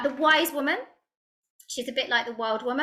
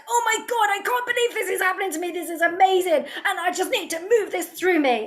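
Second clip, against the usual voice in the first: much higher, gushing and fast, bursting with joy; super happy; 9 semitones higher, 4 dB louder, faster than usual. Retold or imitated. To her audience, imitating herself overjoyed: high excited gush. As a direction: squeal it like the best news ever. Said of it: "I'm either super, super happy, like".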